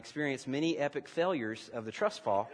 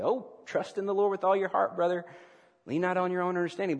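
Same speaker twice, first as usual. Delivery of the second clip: higher-pitched, sing-along stock piety; glib religious phrase; 6 semitones higher, 3 dB louder than usual. Acted. Preacher mimicking someone quoting scripture by habit, glib. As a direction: rattle it off as a pious cliché. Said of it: "just like a religious phrase".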